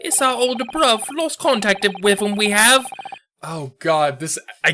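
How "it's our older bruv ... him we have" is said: higher-pitched Cockney mobster voice; a raised, effortful stab at Cockney; attempted Cockney accent. Mobster explaining the lost older bruv, strained Cockney accent. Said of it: "I can't do accents today".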